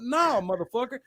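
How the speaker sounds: high-pitched indignant comeback; heated retort without shouting; retold mad refusal. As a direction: reenact yourself refusing to back down. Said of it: "Then I get mad".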